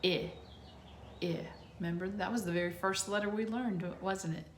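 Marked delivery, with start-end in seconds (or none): 0.0-1.4 short vowel I held clearly; short i sound. Shown as a demonstration. Teacher to kids, short I sound clearly. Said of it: "What's the short I say?".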